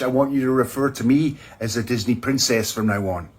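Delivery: usual speaking voice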